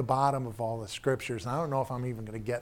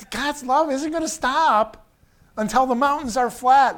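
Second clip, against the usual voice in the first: fervent high call that God's love won't stop; excited preaching; 10 semitones higher, 8 dB louder than usual. Real, not acted. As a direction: preach it with rising fervour.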